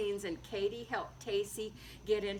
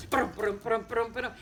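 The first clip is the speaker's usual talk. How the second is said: galloping sound; 4 dB louder than usual. A narrator's reading. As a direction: drum out the gallop with your voice. Galloping horse sound effect.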